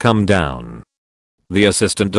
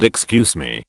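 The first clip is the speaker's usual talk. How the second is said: hard excuse me; 4 semitones lower than usual. Retold or imitated. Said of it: "A hard".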